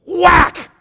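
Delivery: high, loud burst; explosive high sound effect; sudden whack. Retold or imitated. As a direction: punch it out like a kick landing. Kid kicks him suddenly.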